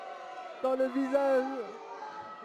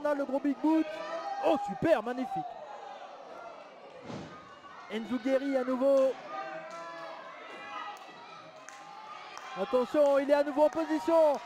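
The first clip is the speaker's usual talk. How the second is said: fired-up live play-by-play call; wrestling commentator; 2 semitones higher, 3 dB louder than usual. Acted. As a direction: call every move like ringside TV.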